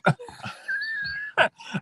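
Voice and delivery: a sudden high-pitched burst of laughter. Real, not acted. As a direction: crack up in a high squeal. Laughs at his own joke.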